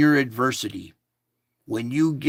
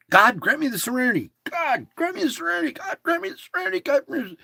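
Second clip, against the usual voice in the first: faster and higher each time; repeated prayer; 12 semitones higher, much faster than usual. Shown as a demonstration. Speaker chanting the prayer, rising and speeding up. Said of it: "I'll even shorten it".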